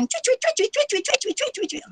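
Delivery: very high squeaky chirps, fading away; bird chirping. Retold or imitated. Teacher mimics the bird's cries as the cat bites it, very high chirps. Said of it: "burung menjerit macam mana mungkin dia bunyilah".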